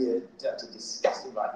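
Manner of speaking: a hammed-up contemptuous sneer; mock disgust. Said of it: "rice and go".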